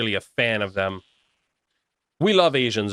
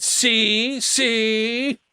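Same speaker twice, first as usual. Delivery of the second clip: high, mocking sing-song see; taunting see see; 10 semitones higher than usual. Gloating at the chat after the flagged message, high and mocking.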